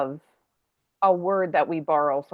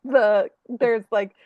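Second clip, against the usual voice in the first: ordinary talk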